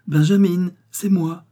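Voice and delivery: high, loud, elated cry; bright-spirited shout of arrival; joyful calling out. A narrator's reading. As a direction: call out gleefully to announce yourself. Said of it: "en criant avec allégresse".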